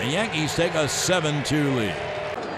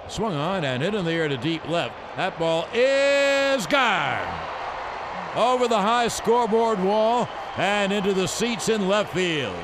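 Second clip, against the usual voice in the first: rising play-by-play to a shout; home run call; 4 semitones higher than usual. Acted. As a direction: build the call to a roaring finish.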